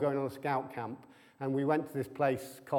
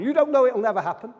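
fast, louder, higher mock anxious wife; wife's retort; 7 semitones higher, 9 dB louder, faster than usual. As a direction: rattle it off like the fretting wife.

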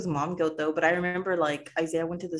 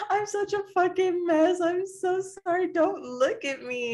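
high, slow mock crying wail; mock sobbing wail; 13 semitones higher, slower than usual. To her husband walking in, a high mock sobbing wail. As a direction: wail it like you're bawling, much higher. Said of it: "bawling my eyes out".

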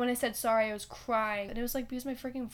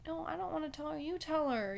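put-on higher, softer reluctant kid; shy refusal; 5 semitones higher, 5 dB quieter than usual. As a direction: shyly push the job onto someone else. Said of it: "I was shy".

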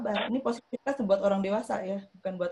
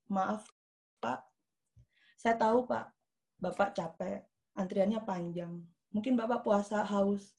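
polite assertive. Acted. Queuer addressing the man who cut in, politely assertive. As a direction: gently show understanding, with small pauses. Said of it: "kalau orang yang asertif gimana".

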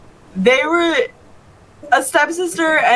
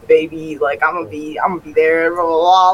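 imitating a deep soothing voice; 7 semitones lower than usual. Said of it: "being like".

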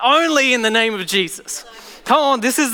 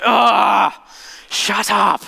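demon's snarl; much slower than usual. Retold or imitated. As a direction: snap back as the cornered demon. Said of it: "You just hear it go".

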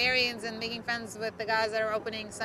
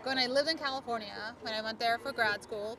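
usual speaking voice

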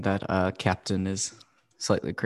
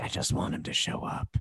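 wistful whisper; 10 dB quieter than usual. Retold or imitated. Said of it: "and be like".

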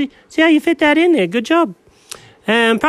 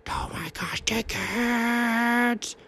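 whisper yelling; 6 semitones higher, 10 dB quieter, slower than usual. Acted. Hiding in car, excited whisper.

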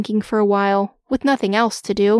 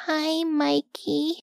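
timid, soft, high greeting; slow, quavering first hello; shaky; 6 semitones higher, 6 dB quieter, much slower than usual. Acted. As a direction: summon a tiny bit of bravery. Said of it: "His voice was so shaky".

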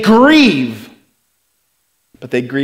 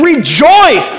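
Paul's exhortation; 11 semitones higher, 6 dB louder than usual. Retold or imitated. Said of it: "what Paul does here is he says".

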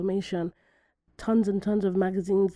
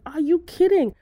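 high, loud, incredulous outrage; indignant rhetorical questions, pitched right up; furious disbelief; 7 semitones higher, 5 dB louder than usual. Retold or imitated. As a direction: re-enact being appalled by what you read. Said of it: "I was getting so furious".

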